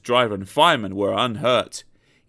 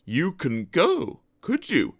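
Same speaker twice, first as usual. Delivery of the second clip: slow, knowing and sly; slow conspiratorial hint; sly wink; 6 semitones higher, 3 dB quieter, slower than usual. A narrator's reading. Gordon slyly hinting to Sir Handel, slow and knowing. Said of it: "he winked".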